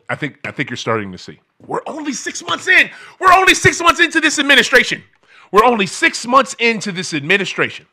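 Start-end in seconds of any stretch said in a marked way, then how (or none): 1.7-7.8 shouted at full force, much higher and louder; furious shout. Real, not acted. Scolding listeners who sat out the vote, shouting furiously. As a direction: yell it in disbelief at the listeners.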